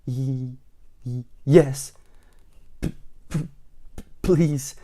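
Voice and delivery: shaky stammered yes, please; quivering stammer. A narrator's reading. Mister Jelly stammers yes to the tramp, quivering. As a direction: tremble through each word. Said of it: "quivered and shook and said".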